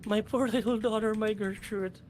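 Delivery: high, halting, distraught mother's voice; the distressed mother explains her daughter was taken, high and broken; grieving mother. Acted. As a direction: stammer out her loss to the strangers.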